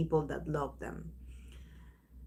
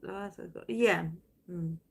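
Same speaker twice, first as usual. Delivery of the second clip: ordinary talk, nothing marked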